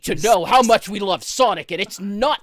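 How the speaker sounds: loud, hammered-out mock fan demands; insistent Sonic fans. Ranting about Sonic fans to friends, mock-insistent fan voice. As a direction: mimic fans insisting at full volume. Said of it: "Sonic fans seem to insist that".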